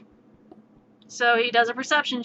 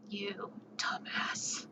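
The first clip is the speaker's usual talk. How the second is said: breathy, slow muttered whisper; whispered insult; 4 semitones lower, 19 dB quieter, much slower than usual. Retold or imitated. Player quietly cursing the samurai who found the dragon. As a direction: hiss it under your breath. Exasperated at reckless teammate.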